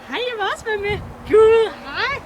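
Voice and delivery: high, loud pretend dog's voice; imitating the eager dog. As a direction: burst in as an excited pet wanting to join. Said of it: "så kommer hun der".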